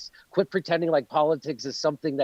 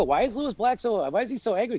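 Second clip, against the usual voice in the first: higher, lightly mocking question; mock puzzlement; 6 semitones higher than usual. Comic imitating people who question anger, mock puzzled. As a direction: imitate a baffled complainer.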